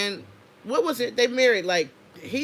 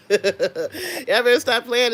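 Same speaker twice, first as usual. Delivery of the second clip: laughing; 2 semitones higher, 5 dB louder, slower than usual. Real, not acted. Laughing after hype.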